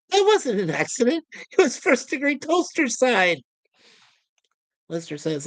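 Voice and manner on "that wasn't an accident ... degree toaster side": lightly voiced toaster, higher; sly little aside in the toaster's voice; a touch of Talkie. Toaster sniping back about the accident, lightly voiced. Toaster snipes at Crichton's excuse.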